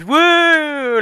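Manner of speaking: high whoop; excited woo. Real, not acted. Showing off her parasite hand to the co-host, excited whoop. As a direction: hype up the reveal.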